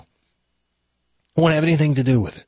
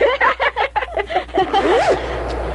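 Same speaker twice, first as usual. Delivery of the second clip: high, bursting laughter. Real, not acted. Studio crew laughing at the host's call.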